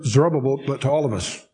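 ordinary talk

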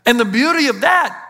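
rising, driving sermon delivery; preaching. Acted. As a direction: preach it out with conviction to the crowd. Preaching sermon at full force.

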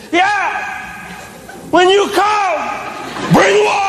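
put-on high, stretched-out kid holler; child's yell. Child shouts water order to parent, mock yelling. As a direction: imitate a child calling out, undeterred. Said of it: "And then she yells".

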